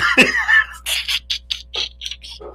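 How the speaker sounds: high, airy laugh; a breathless high laugh at his own joke. Real, not acted. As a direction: let out a squealing laugh.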